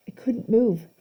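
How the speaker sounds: plain reading voice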